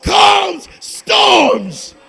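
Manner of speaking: loud, fervent pulpit proclamation; preaching at full force. Acted. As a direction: thunder the scripture over the congregation. Preaching testimony climax.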